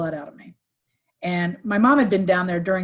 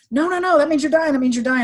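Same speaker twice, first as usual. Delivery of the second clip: panicked refusal; 7 semitones higher, 4 dB louder than usual. Retold or imitated. Brother refusing hospice.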